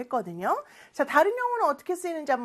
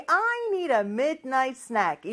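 slow model reading; much slower than usual. Shown as a demonstration. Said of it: "이 표현 한번 큰 목소리로 따라해볼까요".

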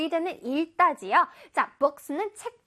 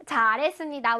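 ordinary talk, nothing marked

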